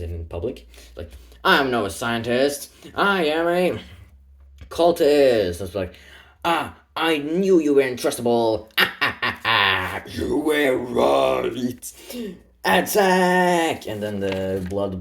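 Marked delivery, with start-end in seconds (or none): 1.4-5.6 dramatic reveal. Retold or imitated. Cultist reveals himself. 6.4-13.9 loud, slow gloating villain; gloat, then order the attack. Retold or imitated.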